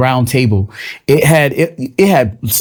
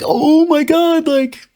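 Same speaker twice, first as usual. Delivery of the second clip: high, slow mock dismay; mock panic; much slower than usual. Mocking Trump voters in a high, slow squeal. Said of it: "and now they're like".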